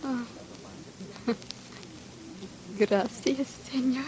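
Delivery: very soft, tender gracias; soft grateful thanks. Acted. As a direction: murmur your thanks gently. Touched, thanking him.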